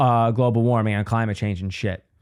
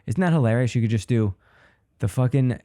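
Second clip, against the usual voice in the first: usual speaking voice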